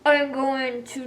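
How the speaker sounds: slow, tired, mid-yawn. Real, not acted. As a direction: drag it out through a yawn. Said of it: "all the yawning".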